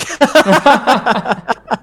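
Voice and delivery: big high burst of laughter; loud laugh. Real, not acted. Player laughing hard at a weak attack. Laughing at own bad roll.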